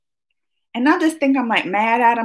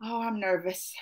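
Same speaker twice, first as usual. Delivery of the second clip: ordinary talk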